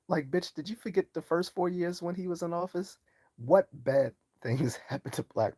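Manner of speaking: high, mocking rhetorical question; scornful challenge. Real, not acted. Mocking a woman who says she'll wake up a slave, high scorn.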